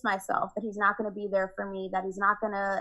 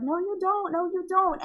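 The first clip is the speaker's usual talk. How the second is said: high protesting no; 8 semitones higher than usual. Retold or imitated. Rejecting partner during bad trip.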